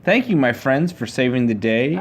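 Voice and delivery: ordinary delivery, nothing marked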